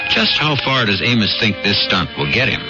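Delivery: usual speaking voice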